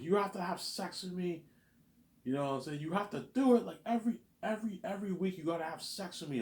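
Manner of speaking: squeaky imitation of a pushy stepmother; mock stepmother pleading. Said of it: "my stepmother was like".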